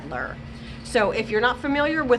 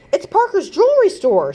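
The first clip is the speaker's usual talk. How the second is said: yelled; 7 semitones higher, 7 dB louder than usual. Acted. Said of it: "she yelled".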